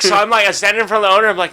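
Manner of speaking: ordinary talk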